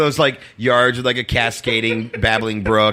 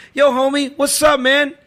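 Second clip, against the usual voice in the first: high, loud, pushy street greeting; guy confronting him; 4 dB louder than usual. Retold or imitated. Stranger walks up to him outside church, imitated high loud greeting. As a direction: call it out high-pitched like a stranger approaching. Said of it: "this guy walks up to me".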